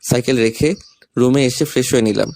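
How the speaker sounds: ordinary talk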